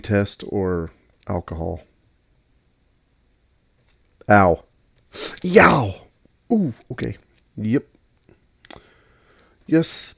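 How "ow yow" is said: sharp yelp of pain; real ouch, finger just burned; burnt fingertips. Real, not acted. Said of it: "I just burned my finger".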